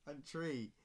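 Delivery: normal talking voice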